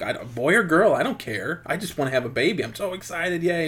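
happy excitement. Acted. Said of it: "Oh, she's so happy".

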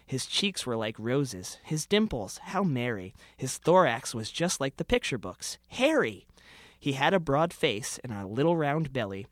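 rhythmic storytelling read of a comic Christmas poem; comic holiday poem recitation. Acted. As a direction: read it aloud with a mock-festive lilt. Reads his Christmas parody poem.

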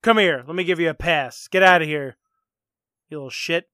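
loud mock teacher calling out; detention teacher. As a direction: bark it at a kid caught in the hallway. Plays detention teacher for skit.

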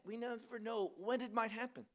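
ordinary talk, nothing marked

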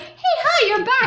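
high, bright mock greeting; cheery greeting. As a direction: chirp a happy hello. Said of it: "He's like".

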